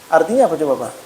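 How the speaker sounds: ordinary talk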